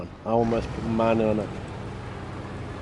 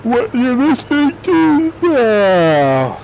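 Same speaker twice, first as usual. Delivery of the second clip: high, slow put-on voice; mocking squeaky drawn-out question; silly put-on voice; 11 semitones higher, 6 dB louder, much slower than usual. Reacting to stream music.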